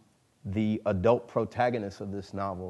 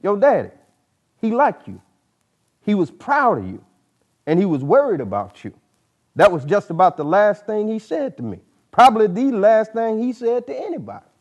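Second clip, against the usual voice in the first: put-on higher, louder old man telling the boy; Old Jack voice; 12 semitones higher, 12 dB louder than usual. Old mentor recalling the father's last words to the boy, raised old man's voice. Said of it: "Old Jack said suddenly".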